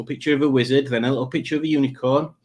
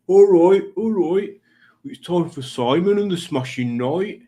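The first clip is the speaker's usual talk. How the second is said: higher-pitched put-on regional accent; Tommy Dapper; 7 semitones higher, 4 dB louder than usual. Reading Tommy Dapper's greeting to viewers, high put-on accent. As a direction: lay on a broad accent, pitched up. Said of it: "Tommy Dapper is here, and he says".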